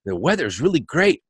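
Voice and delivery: mildly cheery retold hello; lightly acted morning optimism; positive greeting. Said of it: "we were all positive".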